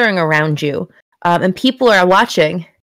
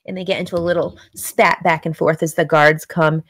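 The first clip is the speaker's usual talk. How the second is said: ordinary talk, nothing marked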